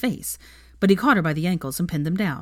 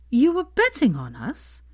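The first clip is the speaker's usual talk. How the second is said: incredulous; 8 semitones higher, slower than usual. Acted. Horrified disbelief.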